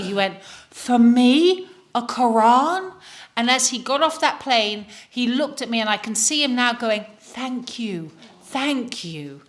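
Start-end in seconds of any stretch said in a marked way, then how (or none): 0.7-2.9 louder, disbelieving rising questions; astonished question. Retold or imitated. Seatmate handed a Quran, imitated astonishment. Seatmate receives an unexpected gift. 7.2-9.4 soft, hushed imitated gratitude; heartfelt thanks. Man leaving the plane with his gift, softly grateful.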